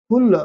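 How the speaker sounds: "फुल" with a quick, jerked vowel; short u, jerked. Shown as a demonstration. Teacher repeating the jerk example, "फुल" cut short. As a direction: keep the u in "फुल" short and sudden. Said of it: "झटके पड़ रहा है ना".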